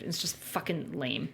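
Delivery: ordinary delivery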